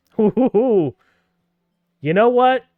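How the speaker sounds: loud high whoop; hyped-up whoop. Acted. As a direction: pump yourself up, shouting. Psyching up for backflip.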